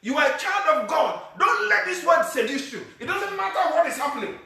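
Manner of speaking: high, loud, drawn-out preaching; preaching at full force. Acted. Pastor rousing his congregation, shouting high and loud. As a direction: thunder it at the congregation. Preaching climax on God's faithfulness.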